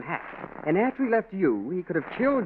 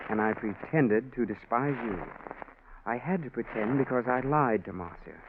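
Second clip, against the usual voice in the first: letter read aloud; 4 semitones lower, 5 dB quieter than usual. Acted. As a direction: read it like a confession from the grave. Reads the dead man's letter.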